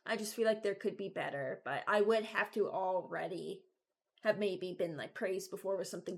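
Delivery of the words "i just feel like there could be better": ordinary talk, nothing marked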